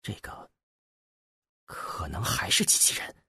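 hushed, whispered aside; low voice. A narrator's reading. Turning his head to confide in his companion, low voice. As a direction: murmur it softly and haltingly, under the breath. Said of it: "低声对包奇斯说".

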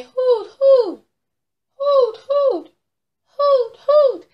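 high, loud falsetto hooting; bright sung owl calls, very high; owl hoots. Acted. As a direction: hoot out in pairs like an owl. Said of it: "Turn your head and say".

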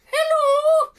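very high, loud squeaky hello; funny Rufus voice. Shown as a demonstration. Asked by his co-host, a squeaky high hello. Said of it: "Let me hear your, your funny Sharoof Rufus voice".

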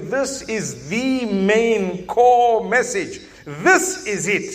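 slow and deliberate, each word weighed; lightly voiced divine message, slow and emphatic; slow, weighty voicing. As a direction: drag it out as a solemn declaration. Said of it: "It's Allah telling you".

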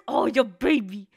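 ordinary delivery

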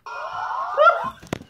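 high shrieking laugh. Real, not acted. Shrieking at screen.

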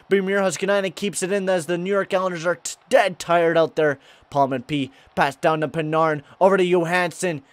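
lively sportscaster calling the action; play-by-play. Acted. Gamer calling his hockey game live like a TV announcer. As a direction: call the play like a broadcaster.

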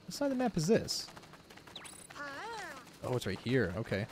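ordinary talk

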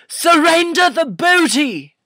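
high, loud pirate bellow from afar; hammed-up pirate yelling a demand; pirate shout. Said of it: "I heard from afar".

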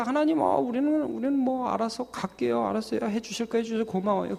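high, very soft voice; offhand polite brush-off. Acted. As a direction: politely excuse yourself, small and soft. Acts people content without God. (작고 높은 목소리로 적당히 사양하듯)

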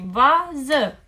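"vază" as VA, pause, ZĂ; slow, separate beats on "vază"; split into syllables. Shown as a demonstration. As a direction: break "vază" after va. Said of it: "silabă la început".

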